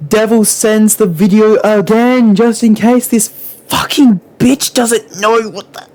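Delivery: high, strained exasperation; fed-up outburst pitched well up; exasperated rant. Real, not acted. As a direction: build in frustration towards a shriek. Exasperated outburst breaking into a shriek.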